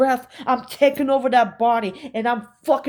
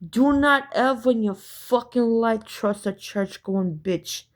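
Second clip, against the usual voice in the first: ordinary delivery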